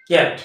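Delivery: ক্যাট in one loud, crisp beat; teacher's one-syllable demo of ক্যাট. Shown as a demonstration. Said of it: "এটা একেবারে উচ্চারণ করলাম".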